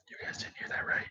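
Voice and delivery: secretive whisper to the viewers. Real, not acted. Slip about workplace, hushing viewers.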